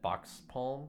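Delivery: Wisconsin accent on Box Palm, flat Midwestern vowels. Shown as a demonstration. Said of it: "you can probably hear my Wisconsin accent when I say that".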